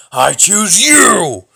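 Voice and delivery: loud, drawn-out rough shout; Batman-like growl. Acted. Choosing a card for battle, loud Batman growl. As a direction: roar it like an over-the-top Batman. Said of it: "we're not being Batman here".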